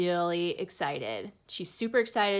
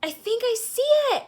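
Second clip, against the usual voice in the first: much higher, louder, fast excited shout; 8 dB louder, faster than usual. A narrator's reading. Girl shouts to the crowd she spots the meteor, excited shout. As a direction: blurt it out, sure she's spotted it. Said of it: "I shout".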